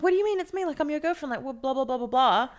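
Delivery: put-on high, shocked girlfriend; indignant disbelief. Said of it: "And she's like".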